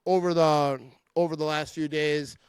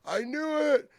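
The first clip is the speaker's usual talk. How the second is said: mock sad fan; 11 semitones higher than usual. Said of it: "I would never be at Lambeau like".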